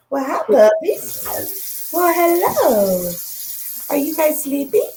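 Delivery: high, slow coo to puppies; puppy talk. Real, not acted. Cooing to her puppies.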